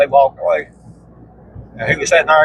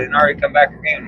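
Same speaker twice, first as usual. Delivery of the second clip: ordinary talk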